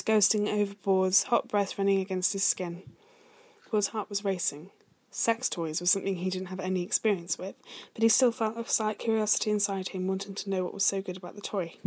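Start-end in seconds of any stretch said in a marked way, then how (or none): none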